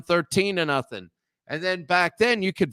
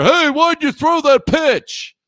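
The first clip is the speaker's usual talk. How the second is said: much higher, much louder, slow hollering coach; mock yell; 11 semitones higher, 10 dB louder than usual. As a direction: holler it across the field at the pitcher. Coach yelling at pitcher.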